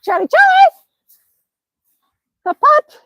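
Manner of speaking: very high squeal to a pet; squeaky puppy-greeting voice; excited dog call. Real, not acted. Calling to the dog outside.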